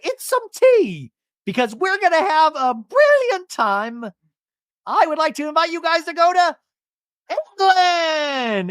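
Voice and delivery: high, stretched-out excited reveal; mock grand announcement. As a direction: imitate a hostess grandly revealing a trip. Shannon announces trip to England.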